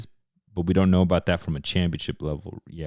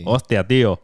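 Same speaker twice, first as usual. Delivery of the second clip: a hint of a Spain Spanish accent; light Castilian accent from Spain; 3 semitones higher, 4 dB louder than usual. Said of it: "In that Spanish accent".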